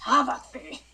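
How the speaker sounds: loud mock knightly challenge; hammed-up duel cry, old-timey; stagey fake archaic declaiming. Mock medieval banter in game.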